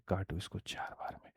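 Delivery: whispered aside to the class; hushed teacher's hint. Real, not acted. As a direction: drop to a whisper for the tip. Teacher aside while solving.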